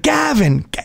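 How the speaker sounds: put-on a drunk guest summoning the host; mock drunk calling. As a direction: call out for him, hammed up. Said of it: "this woman's like".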